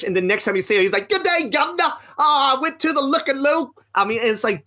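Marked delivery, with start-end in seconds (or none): 1.1-3.7 high-pitched, hammed-up British caricature; over-the-top British "good day, guv'nor" voice; mock British accent. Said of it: "sixty-five percent British".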